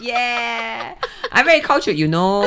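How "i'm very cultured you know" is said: higher, louder mock Singlish echo; Singlish accent. Host echoing guest to show off Singlish, exaggerated accent. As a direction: Singlish imitation of the guest's words.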